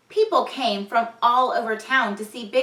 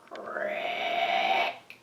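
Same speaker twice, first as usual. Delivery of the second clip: cricket chirp; 5 dB quieter than usual. Acted. Cricket sound effect.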